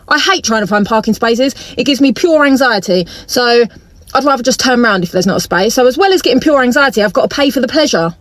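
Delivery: ordinary delivery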